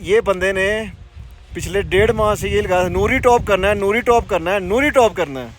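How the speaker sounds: loud, high teasing jab at a friend; mocking teasing. Real, not acted. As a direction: poke fun at his one-track obsession. Teasing friend about his obsession.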